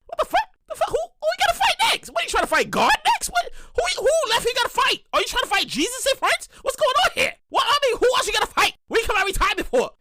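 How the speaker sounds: high, squealing disbelief; high-pitched what-the-hell outrage; incredulous. Real, not acted. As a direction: shriek it in disbelief. Disbelief at Mayweather comeback.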